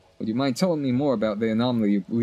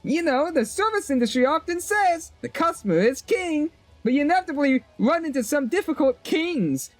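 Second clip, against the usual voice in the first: put-on much higher perky shop girl voice; chirpy shopkeeper. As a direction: voice the cheerful vendor up high.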